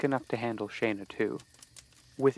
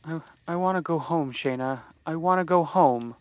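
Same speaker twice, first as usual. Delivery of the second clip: dreamlike, dazed; 5 semitones higher than usual. Acted. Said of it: "Casey spoke with a dreamlike tone".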